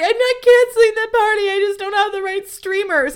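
high, loud mock-distraught protest; acted breakdown. Daughter protesting to dad about the party, high acted breakdown. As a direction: wail it high, imitating your own meltdown. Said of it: "at the end of this breakdown".

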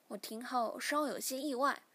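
ordinary delivery, nothing marked

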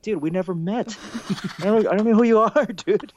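high-pitched protest breaking into laughter; comically disowning someone, voice way up; mock incredulous. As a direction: squeal it in amused disbelief. Acted joking disowning of friend.

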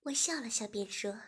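plain reading voice

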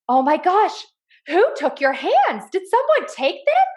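rising to a squeaky, hammed-up gasp of shock; mock astonishment. Mom hamming up shock to make her son open his bar. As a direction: pretend you can't believe his hands vanished.

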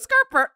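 high-pitched imitated car screech; imitated car skirt-burr. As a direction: squeak out the other car's jerk. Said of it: "And then he goes".

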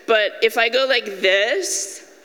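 ordinary delivery

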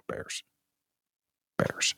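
ordinary delivery

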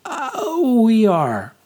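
nervous, high-pitched stammer; girl answering the giant, high nervous stammer. A narrator's reading. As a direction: squeak it out, caught off guard. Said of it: "I stammered".

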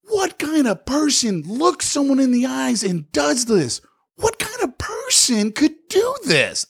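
furious at the salesman in the showroom, rising outrage; outraged rant. Retold or imitated. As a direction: demand an answer in disbelief. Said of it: "And I go".

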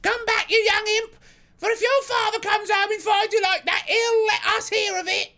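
very high, loud scolding falsetto; shouted. A narrator's reading. Mother warns her runaway boy about father, shouting. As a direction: holler a threat at a naughty child. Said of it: "she shouted".

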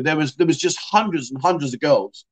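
ordinary talk

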